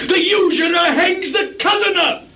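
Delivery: high crying outburst; anguished cry. Acted. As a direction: let the bitterness break through. Lear's bitter outcry.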